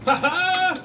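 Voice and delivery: high gleeful cheer; triumphant laugh. Real, not acted. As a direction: crow with victory. Victory cheer.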